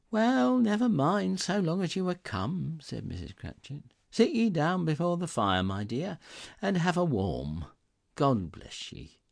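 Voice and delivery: a hint of a doting mother; welcoming mother. A narrator's reading. Mrs Cratchit greets Martha.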